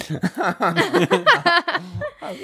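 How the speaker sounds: high drawn-out laughter; long high laugh. Real, not acted.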